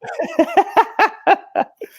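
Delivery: real laughter, high and sudden; high burst of laughter. Real, not acted. Laughing at a friend's joke, a high real burst of laughter. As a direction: crack up at the joke.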